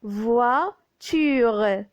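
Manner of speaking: "voiture" drawn out as voi-tu-re; syllable by syllable. Shown as a demonstration. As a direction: stretch "voiture" in separate parts, voi, ture. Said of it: "c'est wa". (« voiture » découpé et allongé en voi-ture)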